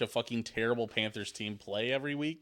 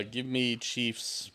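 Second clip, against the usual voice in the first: slow drawn pick; 4 dB quieter, much slower than usual. Real, not acted.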